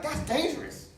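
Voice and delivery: high, loud panicked passenger yelling through a laugh; hammed-up shout at a reckless driver; freaked-out yell. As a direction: passenger squeals in alarm at the driver's behaviour, laughing as he yells. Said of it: "And the dude was like".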